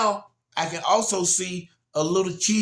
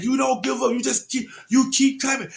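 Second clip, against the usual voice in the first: high retold shout; urgent encouragement; 8 semitones higher than usual. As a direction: cheer on a struggling swimmer. Shouting encouragement to struggling daughter.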